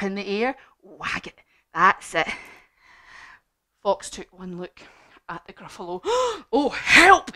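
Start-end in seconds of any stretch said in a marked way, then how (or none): 6.0-7.3 high, loud mock yelp; frightened fox. Fox seeing the Gruffalo, startled yelp. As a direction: cry for help in acted alarm. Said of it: "he said, and off he sped".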